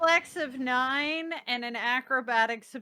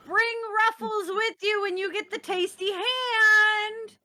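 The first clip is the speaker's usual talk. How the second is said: shouting; 6 semitones higher than usual. Acted. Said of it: "as she's shouting".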